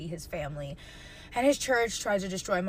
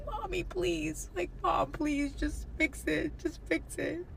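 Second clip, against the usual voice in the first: higher, softer tearful begging; retold crying plea; 6 semitones higher, 6 dB quieter, slower than usual. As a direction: beg mom through tears. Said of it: "I would cry on the phone".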